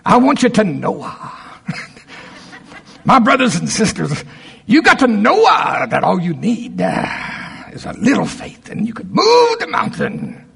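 high, slow, hammed-up preacher drawl with a cry in it; mocking preaching voice. Mocking church preachers to his congregation, in a hammed-up preacher voice. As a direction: lay the sanctimonious preacher on thick. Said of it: "go into my mocking preaching voice".

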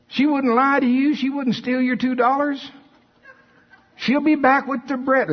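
high-pitched, incredulous insistence; emphatic assurance. Acted. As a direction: insist as if it were obvious. Rebukes doubt about her returning.

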